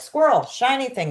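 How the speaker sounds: high, sudden burst of distraction; mock distracted excitement. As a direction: play a mind darting after every novelty. Acts out a distracted mind.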